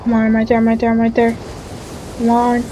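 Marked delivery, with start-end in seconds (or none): none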